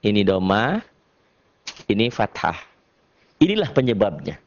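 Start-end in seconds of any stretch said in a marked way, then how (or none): none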